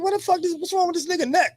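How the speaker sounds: high, startled what-the-fuck; a baffled high yelp at the sight; shocked double take. Retold or imitated. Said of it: "I was like".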